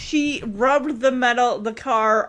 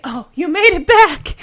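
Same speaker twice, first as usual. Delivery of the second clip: relieved dad; 6 semitones higher, 8 dB louder than usual. Retold or imitated. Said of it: "Danny's like".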